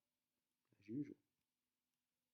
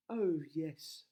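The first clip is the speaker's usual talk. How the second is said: Rapunzel's yes; 6 semitones higher, 6 dB louder than usual. Acted. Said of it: "said Rapunzel".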